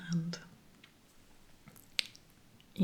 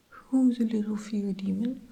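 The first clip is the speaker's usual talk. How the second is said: higher, louder coo, like talking to a puppy; baby talk; 4 semitones higher, 8 dB louder than usual. Retold or imitated. Xander teases the tiny fear demon, in baby talk.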